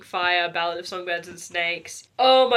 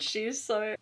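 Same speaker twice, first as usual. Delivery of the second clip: ordinary delivery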